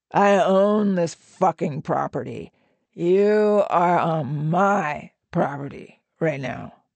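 slow, emphatic, possessive threat; deliberate, controlled anger; menacing assertion. A narrator's reading. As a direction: stretch and lean on key words. Otto angrily asserts his ownership.

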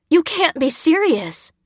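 high, slow, incredulous protest. A narrator's reading. Lucy rushing after the men, protesting. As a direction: protest in loud disbelief. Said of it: "she protested".

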